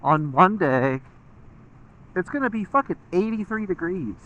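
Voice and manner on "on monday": ordinary talk, nothing marked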